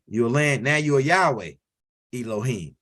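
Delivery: ordinary talk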